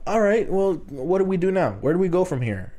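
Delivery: high-pitched, at-a-loss appeal; exasperated questioning. Retold or imitated. Customer stuck with wardrobe lady who can't size him, exasperated. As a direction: start high in helpless exasperation, easing down.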